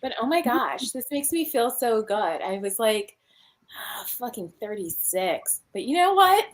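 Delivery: ordinary talk, nothing marked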